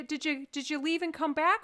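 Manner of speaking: usual speaking voice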